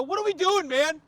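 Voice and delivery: high-pitched indignant plea; retold incredulous protest; exasperated confrontation. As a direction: call him out in a strained high voice. Acted confrontation in driveway.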